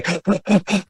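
demonstrated shuddering gasps of crying; sobbing gasp. Shown as a demonstration. Showing how he couldn't stop crying, imitated sobbing gasps. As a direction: mimic breath catching in uncontrollable sobs. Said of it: "I couldn't stop crying".

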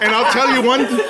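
high, laughing and cut off. Real, not acted. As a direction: laugh through the words. Joking with laughter on stage.

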